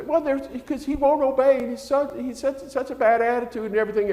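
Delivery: high, quick mimicking complaint; complaining mother. Mother complaining about her son's bad attitude, high mimicking voice. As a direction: rattle off her grievances in her voice. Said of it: "he'd say".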